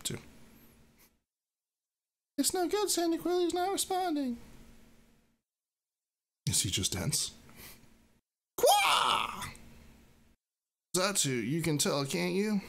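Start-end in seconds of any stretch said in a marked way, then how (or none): 2.4-4.4 much higher, small creature voice; high-pitched Mudkip worried. Acted. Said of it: "I'm reading from Mudkip". 8.6-9.4 shrill-free high squeal of a water Pokemon; high creature shout; high creature cry. Acted. Mudkip cry.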